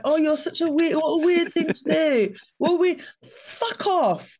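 high, mocking whiny voice; sneering high mimicry, dragged out; mocking impression. Mocking a friend who calls him weird. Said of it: "you're just there going like".

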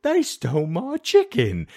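high, slow, hammed-up complaint; mock outraged villager. Offended villager telling everybody about a chicken theft, in mock outrage.

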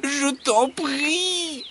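very high, long-drawn plea; imploring squeaky fox voice; begging fox. A narrator's reading. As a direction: beg in a stretched-out high whine. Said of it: "supplie le renard".

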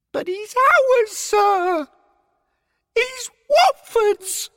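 very high, slow, squeaky protest; insistent. A narrator's reading. Derek protesting to his superior, high and insistent. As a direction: insist in a piping voice, drawing words out. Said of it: "Derek insisted".

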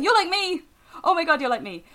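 high, loud delighted squeal; excited. Retold or imitated. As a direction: gush with joy at a kindred spirit. Delighted recognition at convention.

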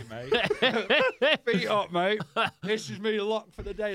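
real laughter breaking through a story; laughing. Real, not acted. Laughing while recalling lazy stoner days.

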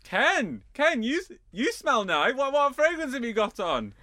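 high sing-song call down the hall; calling to husband from far off in the hall; calling from afar. Retold or imitated. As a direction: call out so the whole house hears. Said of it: "really far away in the hall".

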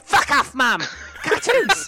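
put-on high, loud childish yell; bratty kid yelling. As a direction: scream it at mum like a spoilt brat. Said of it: "And he goes".

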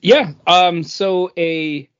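usual speaking voice

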